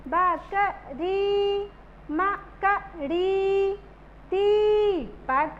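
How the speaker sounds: बकरी, मकड़ी, दीपक with each long ee held out slowly; drawn-out stressed long ee. Shown as a demonstration. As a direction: stretch the ee in री, ड़ी and दी. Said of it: "किस पे जोर देना है". (लंबी ई खींचकर, जोर देकर)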